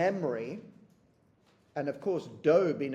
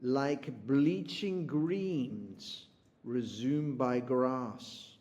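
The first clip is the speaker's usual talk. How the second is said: very slow, lingering reading of verse; poem reading; much slower than usual. Shown as a demonstration. Teacher reading the poem's image aloud, slowly. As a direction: stretch bleaching and let the line fall. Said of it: "And look what they do".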